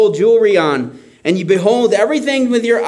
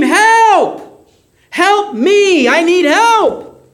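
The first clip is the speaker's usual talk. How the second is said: desperate high scream for help, much higher and louder; 12 semitones higher, 5 dB louder, much slower than usual. Shown as a demonstration. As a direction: yell for help like you're hanging off a cliff. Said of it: "You would scream".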